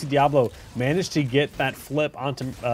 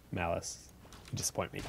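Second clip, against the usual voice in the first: usual speaking voice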